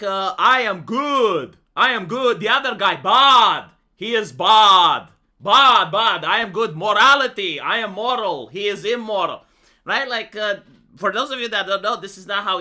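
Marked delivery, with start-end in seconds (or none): 0.4-9.4 slow, loud, simple-minded preacher of good and bad; parody campaign speech. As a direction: boom it out like a cartoon candidate hammering morality. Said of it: "election campaign is like".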